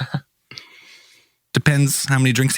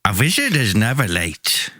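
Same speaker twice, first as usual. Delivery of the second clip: put-on wizard with a hammy Swedish accent; 4 semitones lower than usual. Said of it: "but you're from Sweden".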